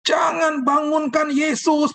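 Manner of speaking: high, slow shout; shouted together. Retold or imitated. Congregation shouting not to wake Jesus, high drawn-out shout. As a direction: yell it out, dragging each word. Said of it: "berteriak semuanya".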